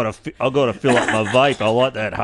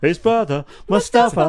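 mock dramatic; 9 semitones higher, 5 dB louder than usual. Mocking the reading.

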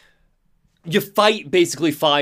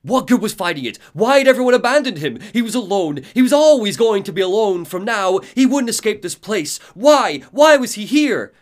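loud, high, anguished outcry; screaming despair; 9 semitones higher, 9 dB louder than usual. Acted. Screens voicing Alan's breakdown, screamed despair. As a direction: cry out like a man breaking down. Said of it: "they're screaming at you".

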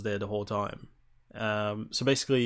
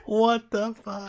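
high, drawn-out incredulous outburst; retold disbelief; 12 semitones higher, 5 dB louder, slower than usual. Player reacting to being caught by his victim, shocked. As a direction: stretch it out in high-pitched shock.

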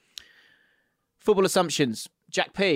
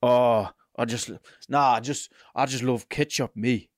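put-on Sunderland accent; 3 semitones lower than usual. Host needing a word to get into it, Sunderland accent. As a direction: feel your way into a Sunderland voice. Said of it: "need a word to get into it".

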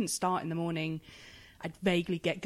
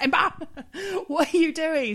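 higher, louder startled mum with a laugh; mock telling-off; 9 semitones higher, 6 dB louder than usual. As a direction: catch the child red-handed. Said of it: "I was like".

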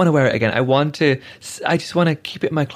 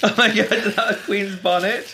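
thrilled, pitched-up gasp of delight; delighted opening a present; 6 semitones higher than usual. Real, not acted. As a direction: squeal over an unexpected gift. Opening a gift on air.

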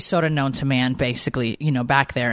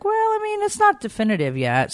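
teasing husband voice. Retold or imitated. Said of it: "And Corey's like".

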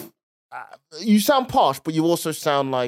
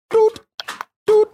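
high, short imitated beeps; loud high "doot" time-signal pips; BBC news pips; 5 dB louder than usual. Shown as a demonstration. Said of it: "B B C".

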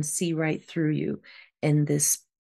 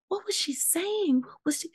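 eager sister; 12 semitones higher, 4 dB quieter than usual. Retold or imitated.

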